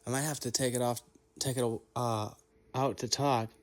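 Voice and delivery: talking around a retainer; words blurred by a dental retainer; wearing a retainer. Real, not acted. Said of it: "I've got my retainer in".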